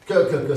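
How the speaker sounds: "que" punched loudly from the back of the palate; explosive K. Shown as a demonstration. Speaker demonstrating back plosives, percussive "que". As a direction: pop each "que" separately at the back. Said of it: "c'est derrière sur le palais".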